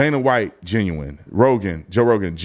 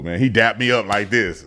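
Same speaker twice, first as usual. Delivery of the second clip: ordinary delivery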